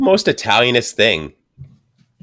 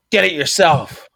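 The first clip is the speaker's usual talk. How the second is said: higher-pitched mock brusque command; hard-as-nails retort; 9 semitones higher, slower than usual. Imitating Scottish wife refusing to fetch water, brusque retort. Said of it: "She's fucking, you know, hard as nails".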